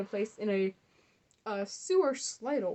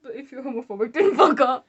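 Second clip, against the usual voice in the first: loud fuck off; 9 dB louder than usual. Real, not acted. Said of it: "Guys, I have a message for you".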